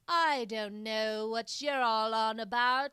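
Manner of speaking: mock old-Hollywood British drawl, swelling at the end; playful imitation of a bad British villain accent; vaguely British villain accent. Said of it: "she develops this weird accent that's, like, vaguely British, but, like, vaguely villain".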